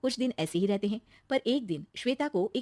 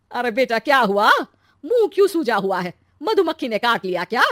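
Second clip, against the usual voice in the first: loud mother quizzing her son; brash concerned mother; 3 semitones higher, 9 dB louder than usual. Acted. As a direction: tease him loudly with worry. Narrator voices mother. (ज़ोर से पूछती माँ)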